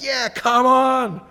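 high, loud, slow "yeah, come on" cheer; excited agreement. Retold or imitated. Preacher reliving agreeing with a Reformed brother, excited cheer. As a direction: cheer him on with gusto.

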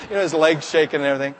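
ordinary talk

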